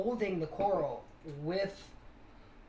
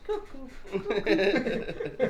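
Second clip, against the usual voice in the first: drawn-out, high, louder cuckoo clock sound; slow cuckoo call; 6 semitones higher, 6 dB louder, much slower than usual. Retold or imitated. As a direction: stretch out each cuckoo like a slow chime.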